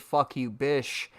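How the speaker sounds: slow, low "bish" for bitch; missing T. Retold or imitated. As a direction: quote it with "bish" ending on sh. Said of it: "pronunciate the T".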